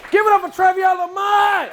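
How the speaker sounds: host announcement. Real, not acted. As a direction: hype the crowd for the next act. Host introducing comic.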